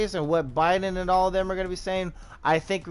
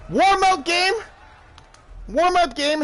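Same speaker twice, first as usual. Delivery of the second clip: mock excuse; 13 semitones higher, 5 dB louder than usual. Real, not acted. Excusing bad play.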